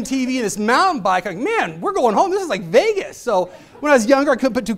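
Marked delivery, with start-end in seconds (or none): 1.4-3.2 high, giddy burst of glee; like a kid spotting the big TV prize; excited. Retold or imitated. Dad at the arcade prizes, giddy and excited.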